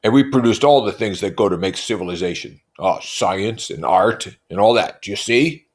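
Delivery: loudly voiced Tom; Tom's voice. A narrator's reading. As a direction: lecture like a brute. Tom voiced in read novel.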